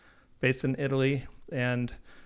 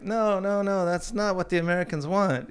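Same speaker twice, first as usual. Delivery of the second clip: lightly voiced dismissive Italian, higher; mock Italian boss; 6 semitones higher than usual. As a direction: brush it off like the Italian office. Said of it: "the Italians would be like".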